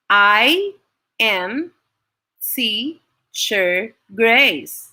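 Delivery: "I am Teacher Grace" in five slow, separated beats; syllable by syllable. Shown as a demonstration. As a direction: count out "I am Teacher Grace" with a pause after each syllable. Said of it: "Let us count".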